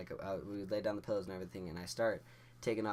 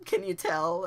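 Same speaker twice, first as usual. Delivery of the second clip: high, loud panicked squeal; hammed-up jittery outburst; nervous squeak; 9 semitones higher, 11 dB louder, slower than usual. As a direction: blurt it in an exaggerated nervous yelp. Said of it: "Are you nervous?".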